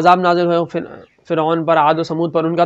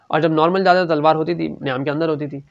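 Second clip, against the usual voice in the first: ordinary talk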